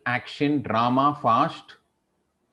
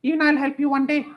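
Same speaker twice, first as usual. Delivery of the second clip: very high, tiny grateful mouse voice; squeaky mouse; 13 semitones higher, faster than usual. Acted. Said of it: "I was going in a squeaky voice".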